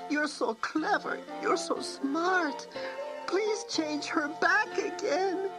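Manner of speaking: put-on high girl's voice begging, slowed on the pleas; girl begging her friend to undo the spell; tearful pleading girl. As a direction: plead for forgiveness, close to tears. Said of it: "wept Antonia".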